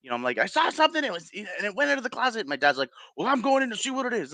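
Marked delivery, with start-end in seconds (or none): 0.4-2.5 put-on much higher, fast childlike report; scared kid. Scared child tells dad. 3.2-4.3 higher, fast mock-dad voice; hammed-up dad marching in; brave dad. Dad decides to check.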